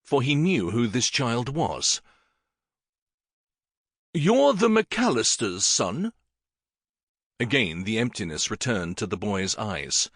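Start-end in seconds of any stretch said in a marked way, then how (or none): 4.1-6.1 higher, slow voice for the king; a surprised king asking the boy; higher surprised royal question; King Henry. Acted.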